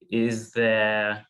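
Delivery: ordinary talk, nothing marked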